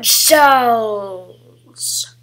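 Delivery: hoarse villain whisper; sinister whispered villain voice. Acted. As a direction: breathe it like a dark lord. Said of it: "speaking in this voice now".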